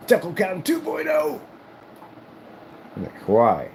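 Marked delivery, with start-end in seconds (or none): 0.1-1.4 high, slow cooing announcement; playful pet voice. Real, not acted. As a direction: coo it out playfully.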